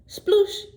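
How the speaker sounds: playful splash noise, high and loud; cartoon splash; high loud sploosh. A narrator's reading. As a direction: make a big splash sound. Splash sound in story.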